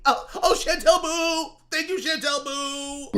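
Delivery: high, slow, wailed thanks; hammed-up tearful swooning over a donor; mock gushing gratitude. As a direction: draw out the pet name, mockingly overcome. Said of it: "But instead he just".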